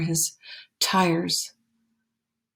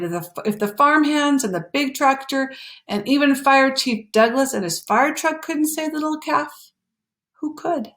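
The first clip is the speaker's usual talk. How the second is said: rising desperation; 7 semitones higher than usual. A narrator's reading. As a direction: voice climbing higher and higher. Farmer despairs, pitch climbing.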